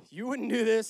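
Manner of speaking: high, loud acted retort; mock forceful retort. Preacher voicing the priest confronting a king, mock forceful retort.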